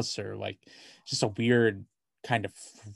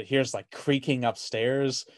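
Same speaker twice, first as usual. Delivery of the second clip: ordinary talk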